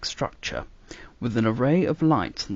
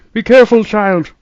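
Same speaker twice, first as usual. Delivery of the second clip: loud, sharp warning; 12 semitones higher, 15 dB louder than usual. A narrator's reading. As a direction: call out to stop her. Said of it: "warned the doctor".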